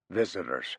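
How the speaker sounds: put-on soft, low warning to the old man; whisper. Archie warns Laddie of the captured sailor, softly. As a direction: keep it quiet so only Laddie hears. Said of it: "Archie whispered to the old man".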